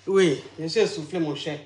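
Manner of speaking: low, soft, winded admission; out of breath. Real, not acted. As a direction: sink down quietly, short of breath. (essoufflé, à voix basse)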